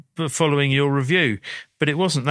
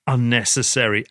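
normal talking voice

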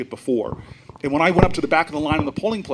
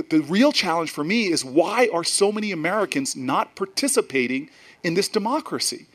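ordinary delivery

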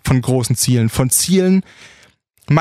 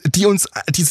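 ordinary talk, nothing marked

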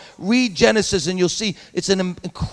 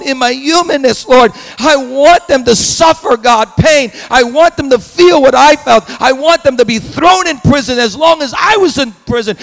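loud, higher anguished cry to God; loud pleading prayer to God; 6 semitones higher, 6 dB louder than usual. Pretend. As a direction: pour out his anger to God in pain. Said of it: "he's probably saying".

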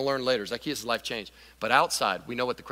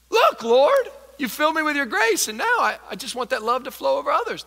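eager Zacchaeus; 10 semitones higher, 5 dB louder than usual. Acted. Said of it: "That's what Zacchaeus is doing".

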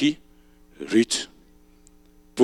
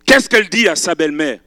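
ordinary delivery

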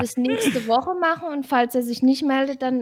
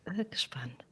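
whispering; 3 semitones lower, 15 dB quieter than usual. Real, not acted. Suspense before winner draw.

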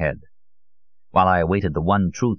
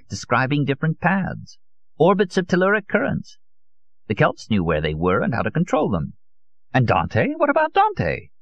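put-on higher-pitched, animated old zealot; 5 semitones higher than usual. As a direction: leap eagerly from one proof to the next.

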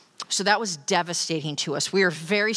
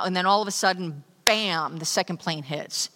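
ordinary delivery

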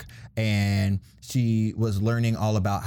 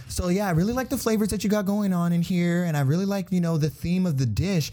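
Aron Sanchez; 8 semitones higher than usual. Retold or imitated. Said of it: "good etiquette English".

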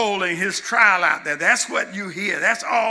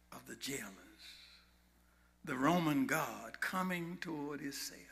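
hushed suspense; 5 semitones lower, 15 dB quieter than usual. Real, not acted. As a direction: hush the church as the guards approach.

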